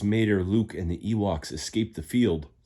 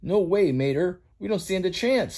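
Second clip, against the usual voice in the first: fearful Fillmore; 11 semitones higher than usual. Acted. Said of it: "said Fillmore".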